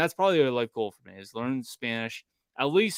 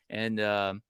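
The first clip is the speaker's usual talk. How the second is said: normal talking voice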